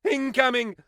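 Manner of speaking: high, loud panicked warning; panicked alarm. Acted. Alarm about the Kraken attack.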